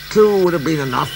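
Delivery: dry. A narrator's reading. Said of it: "said the fat controller dryly".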